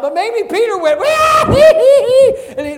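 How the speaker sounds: high laughing squeal; giddy laugh. Retold or imitated. Peter stepping onto the water, a high laughing squeal.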